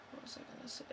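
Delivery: breathy whisper, half to herself as the thought slips away. Real, not acted. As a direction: whisper it while searching for the lost thought. Muttering to herself, losing thread.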